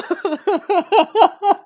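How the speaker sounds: high burst of laughter; laughing. Real, not acted. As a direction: giggle at your own joke. Laughs at own joke.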